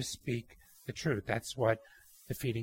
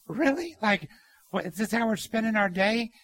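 incredulous; 8 semitones higher, 4 dB louder than usual. Retold or imitated. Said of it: "I'm like".

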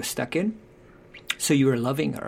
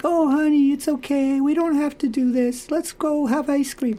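much higher, sugary mock-coddling voice; sentimental indulgent parent; 14 semitones higher, 4 dB louder than usual. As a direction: gush like a parent who gives in. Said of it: "love without power is sentimental and anemic".

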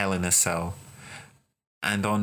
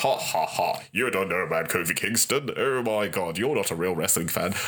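mocking laugh, then sneering at a newcomer; a lightly voiced jeering wrestling snob; snobby gatekeeper mockery. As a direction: play the smug know-it-all fan. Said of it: "We could sit there and go".

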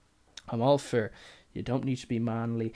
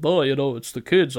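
louder mock excuse of a greedy dad; mock customer excuse; 2 semitones higher, 8 dB louder than usual. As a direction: ham up the lame excuse.

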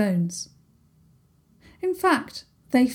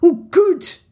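put-on relieved haggis in a broad Scots accent, louder; sleepy haggis glad it's sorted, Scottish accent; thick Scottish accent; 9 semitones higher, 8 dB louder than usual. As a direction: blurt it out glad in a Scots brogue. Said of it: "his thick accent".

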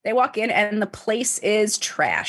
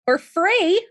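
high echo, "free" sung out higher; high-pitched echo; 11 semitones higher, 4 dB louder than usual. Real, not acted. Storyteller echoing her co-host, high-pitched.

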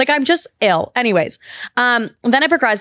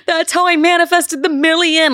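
high-pitched imitation of tearful wailing; hammed-up crying voice; mock bawling; 7 semitones higher than usual. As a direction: wail it like a retold meltdown. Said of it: "I'm bawling my eyes out".

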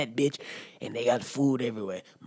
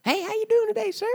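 very high, sugary polite voice; overly polite greeting; 4 dB louder than usual. Retold or imitated. Greeting a stranger in a falsetto-polite voice. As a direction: play the sweet polite stranger. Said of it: "called a cracker".

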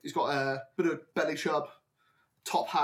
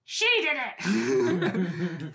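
drawn-out goblin wail; accusing goblins; 2 semitones higher, much slower than usual. Acted. Goblins all point at the culprit, drawn-out accusing wail. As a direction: tattle in a long, whiny cry. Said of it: "They're all like".

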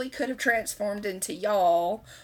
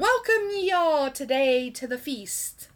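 higher, archaic feast announcement; old-timey formal; 5 semitones higher than usual. Shown as a demonstration. Archaic quote demo.

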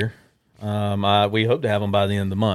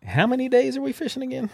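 high put-on wife voice; retelling his wife's question in a high voice; imitating the wife; 13 semitones higher, 4 dB quieter, faster than usual. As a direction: ask it in her voice, lightly puzzled. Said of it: "She said".

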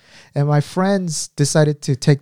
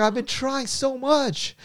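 slow whiny lament; 10 semitones higher, 6 dB quieter, slower than usual. Retold or imitated. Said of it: "He's like".

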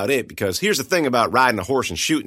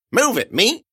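Otis snapping; 12 semitones higher, 4 dB louder than usual. Acted. Otis voiced, shoving.

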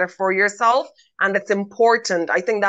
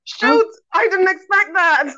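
high, startled exclamation; surprised; 10 semitones higher than usual. Retold or imitated. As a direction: act caught off guard. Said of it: "I'm like".

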